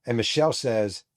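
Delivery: ordinary delivery, nothing marked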